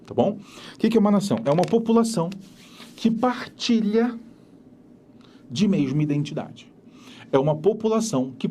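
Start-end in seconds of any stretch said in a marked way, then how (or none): 3.0-4.2 partilha drawn out slowly and higher; partilha, slow and raised; slow emphasis. Shown as a demonstration. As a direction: stretch "partilha" slowly to stress it. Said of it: "teacher stressing key word".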